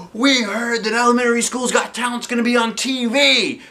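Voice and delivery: high excited yell. A narrator's reading. Science teacher yelling the TV news to staff, high excited shout. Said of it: "yelled Mister Docker".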